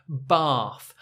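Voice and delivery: bath with a long open ah. Shown as a demonstration. Teacher models the long ah bath. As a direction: say bath as bahth. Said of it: "A with the colon on the left".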